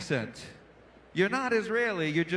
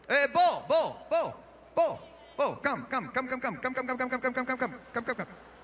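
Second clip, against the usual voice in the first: repeating Israeli; 4 semitones higher than usual. Retold or imitated. Comic mimics an Israeli beckoning in Hebrew then English. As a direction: rattle come come come, dying away. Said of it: "They'll say a Hebrew word, like, eight times".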